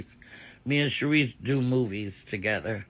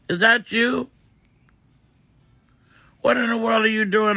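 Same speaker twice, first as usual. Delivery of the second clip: delighted greeting; 6 semitones higher than usual. Real, not acted. Greeting viewer Miss Pretty Ricky in the live chat, high and delighted. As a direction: gush over a favorite viewer in a high voice.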